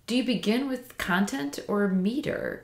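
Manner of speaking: ordinary talk, nothing marked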